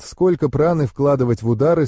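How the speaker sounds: plain reading voice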